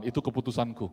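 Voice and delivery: ordinary talk, nothing marked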